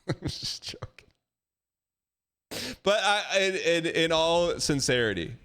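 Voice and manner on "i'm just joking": laughing whisper. Real, not acted.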